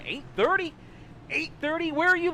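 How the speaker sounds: high, stunned double-take; incredulous. Real, not acted. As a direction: ask it like you can't believe it. Disbelief at viewer's bedtime.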